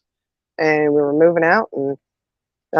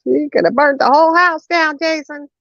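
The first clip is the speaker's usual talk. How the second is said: mother's scolding; 10 semitones higher than usual. Retold or imitated. Mother scolding small son.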